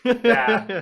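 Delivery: high, loud, laughing burst; loud laughing yeah. Real, not acted. Caught off topic by the hosts, a loud laughing yeah. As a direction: blurt it out laughing.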